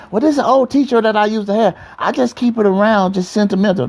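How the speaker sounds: high, sing-song put-on girlfriend voice; mocking girlfriend voice. Preacher mocking a girlfriend's sentimental excuse, high put-on voice. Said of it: "start showing all that stuff and remind".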